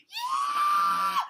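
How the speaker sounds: extremely high, loud screech; long piercing tantrum screech. A narrator's reading. Turtle answers Hippo, screeching. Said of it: "screeched Turtle".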